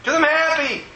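high, fervent outpouring; retold happy bawling. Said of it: "why are you crying".

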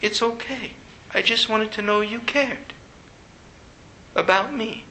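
put-on small, soft little-child voice; hushed imitation of a very young child; three-year-old voice. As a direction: speak quietly as a hurt toddler. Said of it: "It was a three-year-old voice".

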